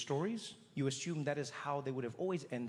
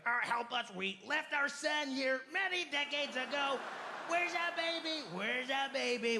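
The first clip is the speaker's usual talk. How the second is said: higher, anxious pleading; pleading alien parents; 10 semitones higher than usual. Retold or imitated. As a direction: beg desperately for the lost child. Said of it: "they're like".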